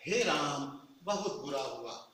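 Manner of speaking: low, soft, slow lament; mournful "हे राम"; grieving exclamation. Shown as a demonstration. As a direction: sigh out the bad news. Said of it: "मैं जिस तरह के tone में बोलता हूँ".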